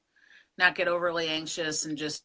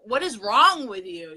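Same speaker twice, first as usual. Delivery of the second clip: louder, slow, exasperated scolding; mock pissed-off yell; 3 semitones higher, 6 dB louder, slower than usual. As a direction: act out scolding a bad driver. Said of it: "my voice gets higher and I get very pissed".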